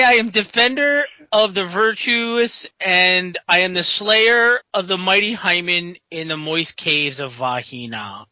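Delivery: slow, grandiose paladin proclamation; Chad Sexington. Acted. Paladin lists his heroic titles to the party, pompous. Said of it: "it's so over the top".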